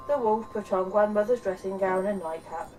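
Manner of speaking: ordinary delivery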